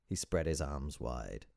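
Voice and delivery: usual speaking voice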